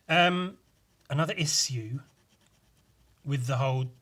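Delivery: ordinary talk, nothing marked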